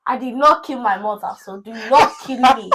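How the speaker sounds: loud, exasperated Nigerian mother; hammed-up overwhelmed mom; stressed Nigerian mom. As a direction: scold the children as a fed-up Nigerian mom. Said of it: "a Nigerian mom is so stressed".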